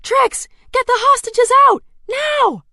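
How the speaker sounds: desperate yell into the comms. Acted. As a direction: scream the order across the room. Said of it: "I yell into my e-glass".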